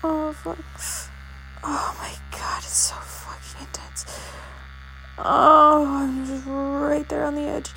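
loud, rising breathless moans; intense moaning. Real, not acted. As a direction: let the moans swell up loud. Building toward climax, moaning louder.

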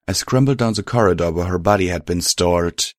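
plain reading voice

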